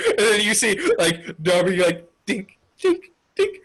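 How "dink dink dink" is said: high little dink noises; small spaced sound effects; imitated dinking. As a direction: make tiny high-pitched clinks.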